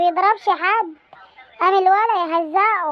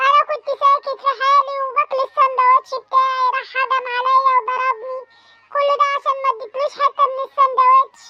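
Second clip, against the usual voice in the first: put-on child voice; 7 semitones higher than usual. Son tattling to the headmaster, high childish voice. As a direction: play the whiny little son telling on the bully. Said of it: "قول له".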